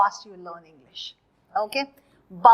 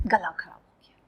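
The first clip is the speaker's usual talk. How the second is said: hoarse whisper; 6 semitones lower, 9 dB quieter, much faster than usual. Pretend. Acts a sore throat.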